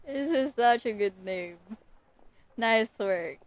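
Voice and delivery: ordinary delivery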